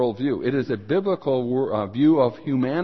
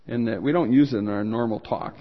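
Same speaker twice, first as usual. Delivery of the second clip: ordinary talk, nothing marked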